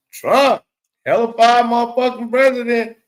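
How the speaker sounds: high, loud mock praise of Trump; mock hype shout. Streamer hamming up praise for Trump to chat, loud mock hype. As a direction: belt it out like a hype man, tongue in cheek.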